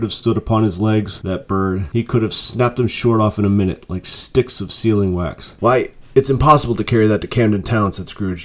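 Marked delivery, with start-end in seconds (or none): none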